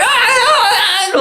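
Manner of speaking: slow, high, loud pained grunts with a laugh; drawn-out grunts. Retold or imitated. Grunting while the nurse counts Mississippis. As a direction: grunt through the pain, slowly.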